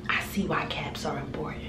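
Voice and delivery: soft whisper to herself; whispered aside. Real, not acted. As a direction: whisper it like a realization.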